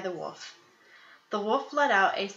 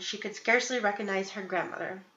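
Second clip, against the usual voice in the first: plain reading voice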